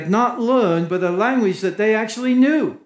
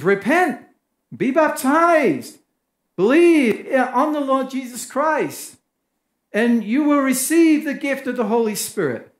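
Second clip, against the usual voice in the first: preaching Peter; 6 semitones higher than usual. Acted. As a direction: proclaim each command with weight. Peter preaches his answer.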